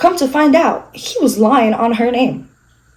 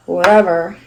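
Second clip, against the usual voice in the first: ordinary talk, nothing marked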